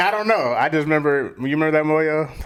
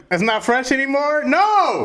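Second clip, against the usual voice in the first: high-pitched hammed-up outcry; mock dismay; 13 semitones higher, 5 dB louder than usual. Reliving losing a favourite slang word, high mock dismay. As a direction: wail in exaggerated disbelief, much higher.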